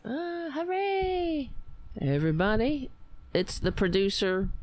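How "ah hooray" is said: high, slow, drawn-out hooray; excited cheer. Real, not acted. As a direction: cheer in delight, stretched out. Cheering the producer's arrival.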